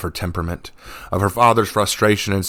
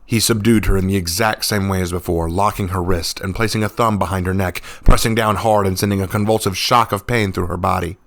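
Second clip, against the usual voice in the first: ordinary delivery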